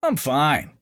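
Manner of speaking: high, breezy confidence; confident reply. A narrator's reading. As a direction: brush off her worry. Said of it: "replied a confident Aiden".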